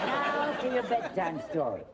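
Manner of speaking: laughing start of a bedtime story; bedtime story opener. Acted. Grandma to grandson, opening his bedtime story with a laugh. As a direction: settle in to tell a story, with a chuckle.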